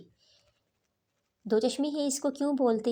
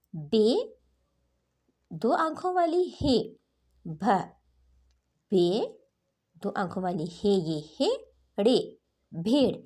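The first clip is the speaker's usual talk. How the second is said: phonics drill; 3 dB louder than usual. Shown as a demonstration. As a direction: spell it out, then land the blended word. Repeats spelling with new name.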